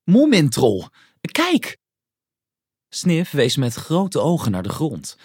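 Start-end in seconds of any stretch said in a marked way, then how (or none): 0.0-1.8 loud, high call that leaps up at the end; excited call. A narrator's reading. Snif pointing Moomin to something glittering, excited shout. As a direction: call out urgently, jumping much higher on the last word.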